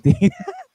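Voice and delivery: loud, very high-pitched laugh. Real, not acted. Bettor amused by his happy rival, high laugh.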